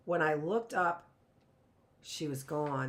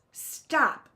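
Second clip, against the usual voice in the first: higher, louder cry; 8 semitones higher, 6 dB louder than usual. A narrator's reading. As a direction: cry out to stop her. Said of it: "Missus Bellwood cried".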